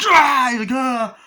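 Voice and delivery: high, loud hammed-up scream; mock scream. Chucky startled by the doll, loud mock scream.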